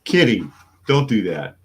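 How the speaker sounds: sharp call to the cat. Real, not acted. Calling out to the cat.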